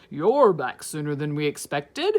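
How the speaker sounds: principal voice. Acted. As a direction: play the principal, a notch down.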